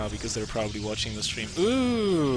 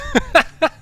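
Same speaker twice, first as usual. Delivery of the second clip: a sudden high burst of laughter, much louder; 10 dB louder than usual. Real, not acted. Caster laughing at his own odd sentence, high loud burst of laughter. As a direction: laugh out loud at your own clumsy wording.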